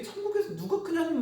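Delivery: amused disbelief. Retold or imitated. Same question, mocking the disciples.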